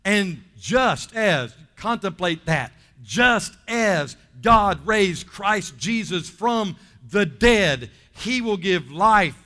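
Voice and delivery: loud, slow, half-sung preaching climax; rising sermon crescendo, drawn out and loud; full-force preaching. Acted. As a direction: stretch every word and lift the church with it.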